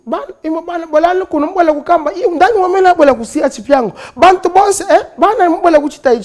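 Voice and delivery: put-on high, loud Congolese-accented Bemba. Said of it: "every time that Mister Chirumba would speak".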